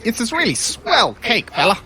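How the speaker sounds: high, drawn-out wheedling voice; chipper persuader. Acted. Companion helps persuade the guard with cake, high and wheedling. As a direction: talk it up, slow and high. Player chimes in to help persuade.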